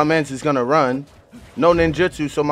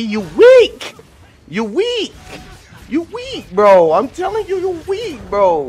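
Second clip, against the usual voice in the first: much higher put-on jeering voice; high cartoonish taunt at the beaten player; squeaky mocking taunt; 11 semitones higher, 6 dB louder than usual. Real, not acted. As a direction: needle him in a silly high voice.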